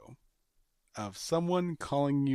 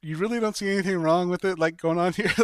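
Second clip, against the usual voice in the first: higher, louder, fast disbelief; incredulous anchor; 5 semitones higher, 5 dB louder, faster than usual. Pretend. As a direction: blurt it out, shocked they don't see it. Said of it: "you're like".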